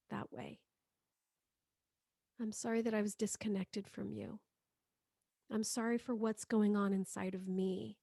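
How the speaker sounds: soft prayer to a mother, fading almost to nothing at the end; tender spoken prayer. Acted. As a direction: speak it like a quiet apology to her.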